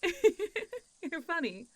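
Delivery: high, slow words through a laugh; giggling at a silly impression. Real, not acted. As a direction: laugh it out, charmed. Laughs at listener's Dracula impression.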